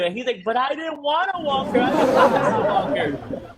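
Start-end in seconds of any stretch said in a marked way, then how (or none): 0.4-1.8 put-on much higher whiny kid protest; imitated crying son. Son protesting to mom after falling, mock whiny cry. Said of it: "so he starts crying". 1.8-3.5 put-on slow, higher, laughing through the kid's whine; mock the boy while cracking up. Teasing the son's complaint, slow laughing whine. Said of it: "you making fun of me".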